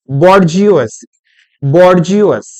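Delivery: बॉर्जियोस as "bor-jee-os", every letter sounded; spelling pronunciation. Shown as a demonstration. Teacher reads the spelt-out word to students, बॉर्जियोस as bor-jee-os. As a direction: sound out बॉर्जियोस firmly, hard r and j. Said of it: "ये word बना बच्चों".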